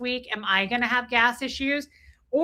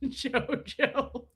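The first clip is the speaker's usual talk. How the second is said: laughing; 3 semitones higher, 6 dB quieter than usual. Real, not acted. Laughing while reading the name.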